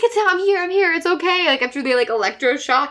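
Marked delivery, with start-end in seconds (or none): 0.0-1.5 mock reassuring girlfriend. Voicing Addison comforting Zed.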